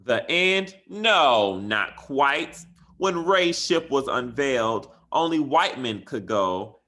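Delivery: reading a story aloud. Acted. Teacher reading a bedtime story to her class, lively and bright. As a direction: bring the rhyming picture book to life.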